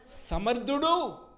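high, loud and stretched slow; drawn-out triumphant cry; proclaiming shout. Real, not acted. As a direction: ring it out as the sermon's peak. Preacher's climax proclaiming God able.

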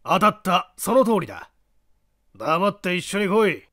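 put-on commanding gruff old man; creaky wise old man. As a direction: bark the order. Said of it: "小男の一喝".

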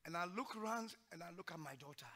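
soft, choked-up telling of a painful memory; emotional. Real, not acted. Father recalling his daughter asking for ice cream, softly emotional. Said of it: "I become a bit emotional".

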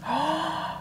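a high, sudden gasp of delight; sharp gasp. Real, not acted. Seeing the Lovers card, a real startled gasp. Reacting to final tarot card.

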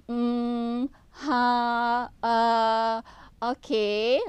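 drawn-out, level hesitation noises; demonstrated fillers. Shown as a demonstration. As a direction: stall on each filler as an example. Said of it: "What are examples of fillers?".

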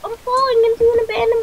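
panicked shout. Real, not acted. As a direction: scream for help while falling. Falling into mine shaft.

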